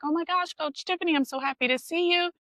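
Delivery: high-pitched mock excited greeting; retold delighted greeting. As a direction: gush like a thrilled former student. Delighted greeting on meeting his old coach.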